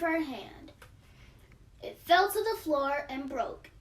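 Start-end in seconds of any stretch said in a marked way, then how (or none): none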